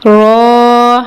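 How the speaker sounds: رو said full-mouthed and heavy; heavy letter demo. Shown as a demonstration. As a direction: fill the mouth for رو. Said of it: "اس مفخم ادا کرنا ہے". (رو مفخم، منہ بھر کر)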